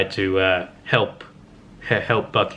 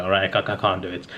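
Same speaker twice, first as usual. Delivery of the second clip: normal talking voice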